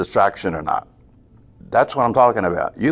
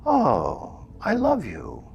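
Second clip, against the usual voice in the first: higher, soft and loving; soft, affectionate; tender love; 6 semitones higher, 7 dB quieter, much slower than usual. Shown as a demonstration. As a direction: speak to the mind like to a little child. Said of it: "it's like".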